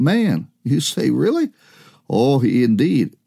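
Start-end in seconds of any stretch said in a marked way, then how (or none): 1.1-1.5 high-pitched surprised "Really?"; surprised questioning. Acted. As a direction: voice a listener's incredulous question, pitch lifted. Voices the listener's doubting question.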